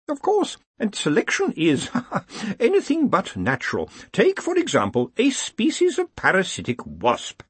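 put-on chuckling old lecturer voice; old man musing, high; elderly voice. Elderly voice speaks.

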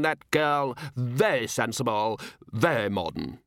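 high hearty know-it-all; pompous gentleman. Acted. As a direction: explain it smugly to the room. Pompous guest explains.

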